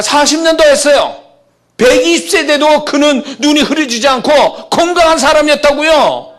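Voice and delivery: high, loud, shouting preacher; preacher shouting at the climax; shouted preaching. Acted. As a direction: thunder the story of Moses at the crowd.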